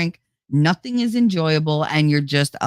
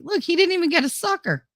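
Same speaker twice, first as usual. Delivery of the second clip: put-on much higher, childish complaint; high mock whine; 12 semitones higher than usual. After a toothache, high mock whine. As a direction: squeak it like a cheated kid. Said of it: "all you get is like".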